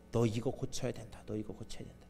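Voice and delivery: soft, fading tender warning; gentle correction. Acted. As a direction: correct him kindly, voice sinking to a hush. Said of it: "부드러운 이 대화를 통해서". (부드럽게 타이르듯 점점 작아지는 목소리)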